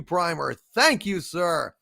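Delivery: ordinary talk, nothing marked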